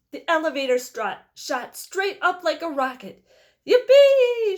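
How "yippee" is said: very high, very loud whoop of glee; joyful shout. A narrator's reading. Grandpa Joe as the elevator shoots up, joyful whoop. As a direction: whoop like an old man on a rollercoaster. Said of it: "shouted Grandpa Joe".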